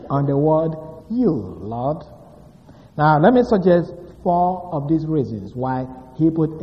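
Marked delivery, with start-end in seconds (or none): 1.1-1.3 "you" set apart, high then falling; single out "you" after a short break; an emphatic, falling "you"; stress on you. Shown as a demonstration. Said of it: "David puts emphasis".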